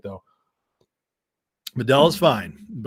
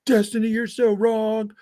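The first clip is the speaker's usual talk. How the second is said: high, hammed-up troll heckling; mock troll voice; 8 semitones higher than usual. Imagined troll jumping into Destiny's stream, hammed-up and high. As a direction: play a ridiculous chat troll shouting at a streamer. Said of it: "jump in and be like".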